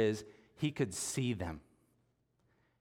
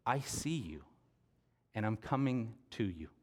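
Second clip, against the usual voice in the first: gentle reassurance; 4 dB quieter than usual. Pretend. God speaking over the hurting.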